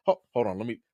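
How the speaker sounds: usual speaking voice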